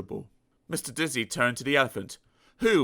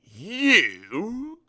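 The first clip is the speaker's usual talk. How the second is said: rude grunt; 8 semitones higher, 5 dB louder than usual. Acted. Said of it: "grunted the pig rudely".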